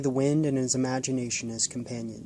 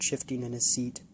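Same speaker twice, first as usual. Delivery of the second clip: ordinary delivery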